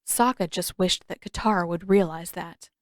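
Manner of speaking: ordinary delivery, nothing marked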